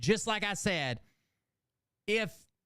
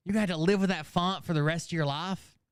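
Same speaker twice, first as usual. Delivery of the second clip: ordinary delivery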